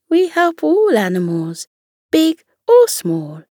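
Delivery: reply brightly to the little hedgehog. Acted. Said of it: "she replied".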